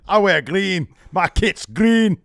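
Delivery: loud, slow Scottish-style wrestler voice; Joe Coffey impression. Host impersonating a wrestler, loud slow Scottish accent. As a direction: bellow slowly like a Glasgow brawler. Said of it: "in the style of Joe Coffey".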